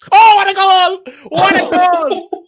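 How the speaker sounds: high, drawn-out goal scream; long goal yell. Real, not acted. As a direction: bellow it like a fan celebrating. Celebrates goal live.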